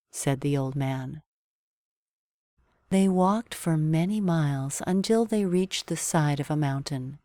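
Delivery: ordinary delivery, nothing marked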